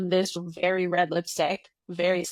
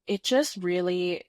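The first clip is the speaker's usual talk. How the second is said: usual speaking voice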